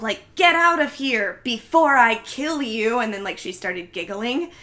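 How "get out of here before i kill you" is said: raised, louder mock yell, drawn out; imitated yell. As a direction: act out a scripted threat at moderate yell. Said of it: "she is supposed to yell".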